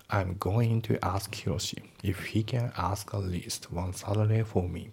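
slow, careful English example; measured textbook read-aloud; slow model reading. Shown as a demonstration. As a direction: read it word by word.

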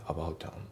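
"アバウトアン" joined as "aboutan"; linked pronunciation. Shown as a demonstration. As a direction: carry T into A in "アバウトアン". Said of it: "リンキングで".

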